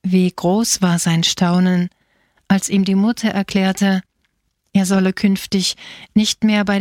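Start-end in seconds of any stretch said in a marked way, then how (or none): none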